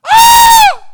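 sudden piercing high scream; scream of pain. Retold or imitated. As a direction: yelp like a spasm just hit. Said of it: "I would scream".

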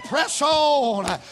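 loud, rising preaching cadence; full-force preaching. Acted. Preacher rallying the church, loud cadence. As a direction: drive it home to the congregation.

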